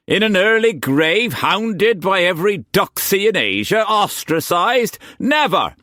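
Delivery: high, loud theatrical protest; rant, all mock horror; outraged Quantz. A narrator's reading.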